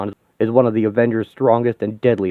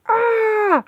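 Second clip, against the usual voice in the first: high battle yell. Acted. A hero yells while smashing a robot. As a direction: yell through the strike.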